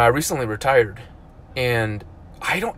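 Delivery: ordinary talk, nothing marked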